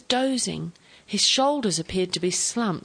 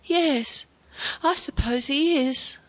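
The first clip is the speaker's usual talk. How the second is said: high, wistful resignation; sad agreement; 6 semitones higher, slower than usual. A narrator's reading. Grandfather accepts his father's death, agreeing sadly. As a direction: give in sadly, pitch raised. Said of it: "he agreed sadly".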